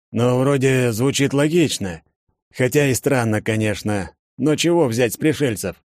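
put-on a doubtful older man muses; gray-mustached man voice. Sedousy speaking in story.

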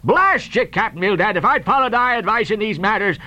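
high-pitched, ranting sea captain; blustering, furious tirade; roaring rage. Acted. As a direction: rage at your partner without stopping. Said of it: "roared Peleg".

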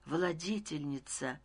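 soft, hushed narrated murmur; whispering. A narrator's reading. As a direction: breathe the title quietly, almost to yourself. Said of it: "прошептал я". (тихо, почти шёпотом)

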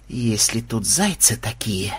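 low, soft, slow dread; haunted. A narrator's reading. Ksenobait glancing round after the fight, haunted hush. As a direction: say it slowly, cowed and hushed. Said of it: "затравленно оглядываясь, произнёс Ксенобай".

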